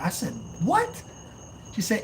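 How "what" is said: high-pitched, rising yelp; mock disbelief. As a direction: squeak it out as a stunned question. Retelling his shocked reply to mom.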